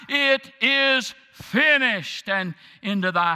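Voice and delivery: high, loud, ringing pulpit shout; climactic preaching. Acted. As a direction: roar the climax out to the whole church. Climactic sermon at full force.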